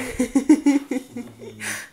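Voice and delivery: bright burst of laughter; delighted laugh. Real, not acted. Mother laughing at her toddler's bear roar.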